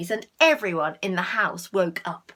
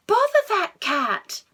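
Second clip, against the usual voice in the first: high, loud, slow grumble; exasperated exclamation; 9 semitones higher, 6 dB louder than usual. Acted. Mrs Thomas finds her hat squashed, loud exasperation. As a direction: sigh it out in annoyance. Annoyed mother again.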